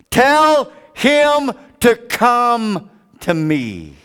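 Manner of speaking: high, slow and commanding call; voice of Jesus. Acted. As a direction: call him over slowly and loudly. Jesus calls the beggar.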